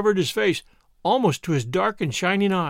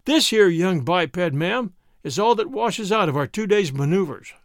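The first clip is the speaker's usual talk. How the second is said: ordinary delivery, nothing marked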